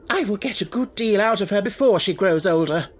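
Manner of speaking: high, loud and grim; vengeful exclamation. Acted. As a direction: promise it with spite. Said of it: "exclaimed Miss Minchin".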